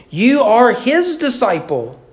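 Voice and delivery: high, slow, snooty religious elite; drawn-out, superior put-down; haughty mockery. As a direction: sneer it with your nose in the air. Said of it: "The noses in the air".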